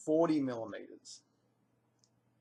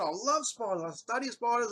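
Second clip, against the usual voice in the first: enthusiastic; 10 semitones higher, 5 dB louder than usual. Retold or imitated. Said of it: "I said".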